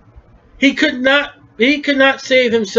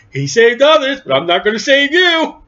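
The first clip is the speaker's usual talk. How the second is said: higher-pitched, stern verdict from the throne; Jesus pronouncing judgment; 5 semitones higher than usual. Acted. Preacher voices Christ to the condemned mockers, pronouncing judgment. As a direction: climb in pitch toward a pointed refusal.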